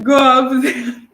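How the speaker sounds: put-on South African accent. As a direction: try the accent while laughing. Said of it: "I've forgotten the accent".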